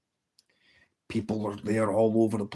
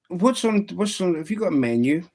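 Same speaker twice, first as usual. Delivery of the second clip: high little rabbit voice, a bit louder; 7 semitones higher, 3 dB louder than usual. Acted. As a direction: play the cheeky rabbit asking for the menu.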